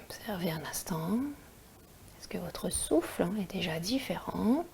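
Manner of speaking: soft whispered breathing guidance; guided meditation. Acted.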